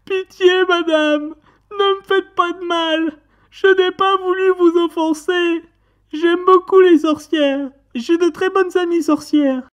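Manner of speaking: very high, loud squealing plea; desperate begging. Acted. Man on his knees before the witch, pleading. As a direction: wail for mercy way up high. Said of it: "je me mets à supplier".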